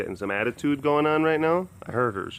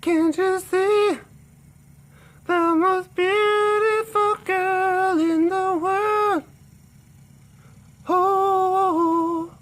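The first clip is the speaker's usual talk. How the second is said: pet talk; 6 dB louder, much slower than usual. Real, not acted. Cooing at a cat.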